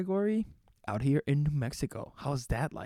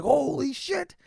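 much higher, louder, thrilled disbelief; ecstatic outburst; 8 semitones higher, 4 dB louder than usual. Retold or imitated. Retelling the moment he learned he placed second, thrilled. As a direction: burst out like you just won big. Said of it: "I was ecstatic".

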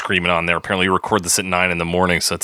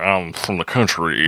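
country drawl; 3 semitones lower than usual. Retold or imitated. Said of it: "a [name] impersonation".

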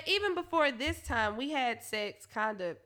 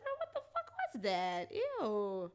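disgusted; 2 semitones lower, 5 dB quieter than usual. Retold or imitated. Reacting to own weird noise.

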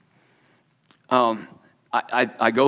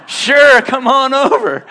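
laughing invitation; 12 semitones higher, 11 dB louder, slower than usual. Real, not acted.

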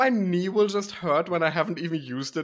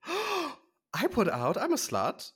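mock gasp; 8 semitones higher, 4 dB quieter, much slower than usual. Gasps at insult.

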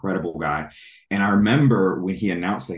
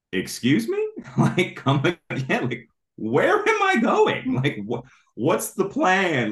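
high-pitched, louder incredulous retelling, a laugh in it; stunned disbelief; 13 semitones higher, 4 dB louder, slower than usual. Retold or imitated. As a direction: replay the shock of hearing it, voice jumping high. Retold shock at God's message.

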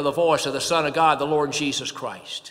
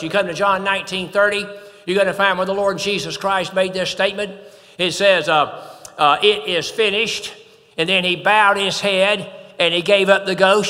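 louder, driving pulpit delivery; fervent preaching; 2 semitones higher, 4 dB louder than usual. Acted. Preacher proclaiming Christ's control to the congregation, fervent. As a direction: preach it home with full conviction.

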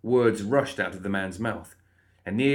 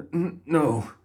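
higher, stammered polite refusal; nervous stammer; 6 semitones higher, slower than usual. A narrator's reading. Frightened guard refusing ice cream, stammering. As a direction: trip on the first word, nervously. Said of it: "the guard stammered".

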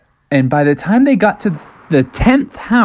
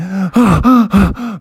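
out-of-breath gasps after running; panting gasps; 6 semitones higher, 3 dB louder than usual. Acted. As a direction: pant hard, just escaped. Bears arrive home out of breath.

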